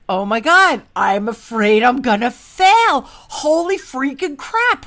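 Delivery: loud, drawn-out mock alarm; hammed-up confession at full volume; retold laughing realization. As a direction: blurt out the realization, big and slow. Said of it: "I cracked up laughing and I said".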